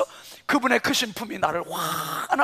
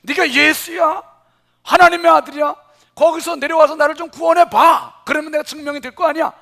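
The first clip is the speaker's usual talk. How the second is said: loud, higher taunting jeer; mocking taunt; 7 semitones higher, 10 dB louder than usual. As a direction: sneer at him from the cross. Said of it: "막 비방하고 욕하는 거예요".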